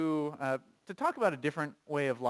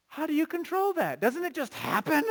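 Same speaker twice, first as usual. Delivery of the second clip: mock incredulity; 11 semitones higher, 4 dB louder than usual. Rhetorical incredulous questions.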